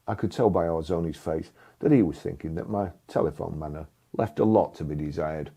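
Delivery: ordinary talk, nothing marked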